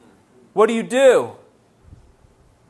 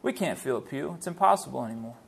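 low, much softer defeated voice; discouraged naysayer; 8 semitones lower, 12 dB quieter, faster than usual. Acted. Church doubter saying it's impossible anymore, discouraged and quiet. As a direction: drop low and quiet as if giving up.

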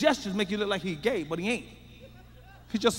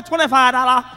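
mock salon worker; 3 semitones higher, 9 dB louder than usual. Quoting nail salon price demand.

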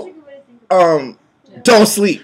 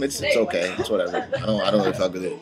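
mock dismissive; 11 semitones lower, 12 dB quieter, much faster than usual. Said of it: "Just kidding".

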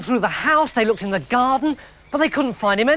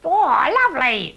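much higher, louder delighted "Oh"; pleased villain; 6 semitones higher, 3 dB louder, slower than usual. A narrator's reading. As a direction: savour it like a treat. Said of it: "he said".

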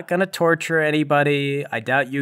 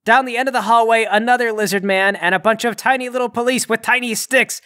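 raised, breathless-paced urgency; dramatic chase narration; 9 semitones higher, 4 dB louder than usual. A narrator's reading. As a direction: announce the trap with high excitement. Game master narrates a chase.

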